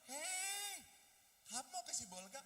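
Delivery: high, drawn-out "hei" greeting; chatty extrovert. Acted. Extrovert greeting seatmate on the boat, high call. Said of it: "ada juga yang orang baru duduk".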